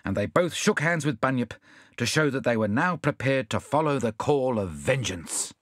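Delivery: ordinary delivery, nothing marked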